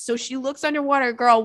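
ordinary talk, nothing marked

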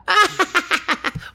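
very high cackling laugh; witch cackle. Acted. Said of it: "Do your witch voice, though".

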